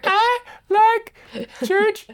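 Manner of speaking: put-on very high, loud little-kid voice; preschooler impression. Church podcast host pretending to be a guest from the hall, preschooler impression. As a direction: squeal it out like a tiny preschooler. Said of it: "that was my preschooler impression".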